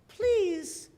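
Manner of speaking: high, pleading attempt at an English accent; bad English accent. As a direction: play a begging English boy, accent hammed up. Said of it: "I have a horrible English accent".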